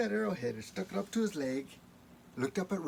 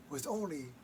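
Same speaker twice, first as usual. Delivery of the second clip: ordinary delivery, nothing marked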